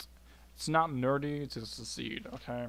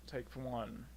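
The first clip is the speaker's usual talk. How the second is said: normal talking voice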